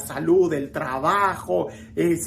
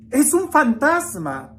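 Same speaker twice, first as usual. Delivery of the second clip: higher, slower narrated alarm; understated cry of terror; 7 semitones higher, 3 dB louder, slower than usual. A narrator's reading. Disciples seeing a figure on the water, mildly alarmed. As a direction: read the frightened cry with restraint. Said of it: "daban gritos de terror".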